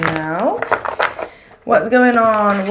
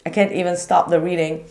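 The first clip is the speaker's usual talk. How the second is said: normal talking voice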